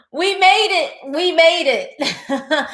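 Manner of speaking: laughing with joy. Real, not acted. Vlogger to viewers, slow and laughing happily. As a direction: laugh it out, overjoyed. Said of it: "I am so happy".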